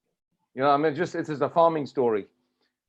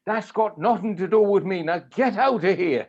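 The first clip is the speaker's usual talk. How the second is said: higher, mock-gruff brush-off; gruff dismissal; 6 semitones higher, 4 dB louder than usual. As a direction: play the grumpy hermit sending him away. Father dismissing the visitor.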